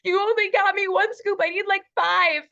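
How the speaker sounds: high, loud near-crying whine; whiny hurt little boy. Retold or imitated. Imitating her husband complaining about the hot sauce, high tearful whine. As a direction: whimper it like a wronged child. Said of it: "He's like".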